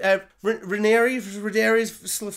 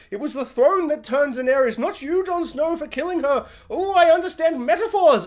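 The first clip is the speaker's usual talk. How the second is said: mock dragon voice; 7 semitones higher, 3 dB louder than usual. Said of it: "he's like".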